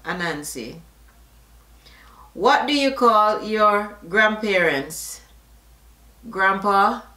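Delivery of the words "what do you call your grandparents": usual speaking voice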